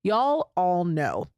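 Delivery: slowed right down to land the point; slow and deliberate, making sure it sinks in. Real, not acted. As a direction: lean on every word, unhurried. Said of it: "we're gonna pause here so that you can hear".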